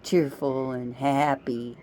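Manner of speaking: slow, savoring the word happy; happy said slowly and drawn out. Shown as a demonstration. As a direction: stretch out happy lovingly. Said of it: "Don't you like the way I say happy?".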